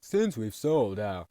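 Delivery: slow, loud, grand cartoon boss voice; boss announcement. Factory owner announcing free chocolate, slow and loud. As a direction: boom it out like a pompous factory owner. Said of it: "Then Mister Nezer's like".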